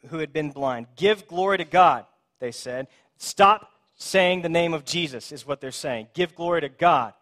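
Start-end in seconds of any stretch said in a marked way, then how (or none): 1.0-2.1 louder, higher demand from on high; stern command. Acted. Pharisees ordering the healed blind man, stern command. 3.2-5.3 slow, louder word-by-word order; deliberate, forceful prohibition; stern command. Acted. Pharisees silencing the healed man, stern command. 6.1-7.1 stern command. Acted. Pharisees ordering the healed blind man, stern command.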